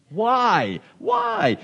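put-on high-pitched kid's whine; questioning kid. Kids always asking parents, imitated whiny why. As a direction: leap up high like a pestering child. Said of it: "what's the question kids always ask".

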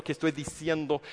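ordinary talk, nothing marked

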